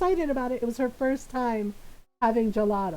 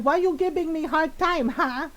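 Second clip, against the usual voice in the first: put-on higher, put-upon Filipino-accented mom; mom's Filipino accent; 4 semitones higher than usual. Mom scolding her child, in her Filipino accent. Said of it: "in my mom's voice".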